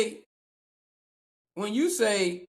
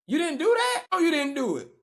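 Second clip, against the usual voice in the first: mock surprise; 7 semitones higher than usual. Host feigning shock that the coach skipped film, high mock surprise.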